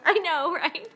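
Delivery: giggling, teary laughter; laughing through tears. Real, not acted. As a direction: laugh until your eyes water. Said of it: "tearing up my eyes".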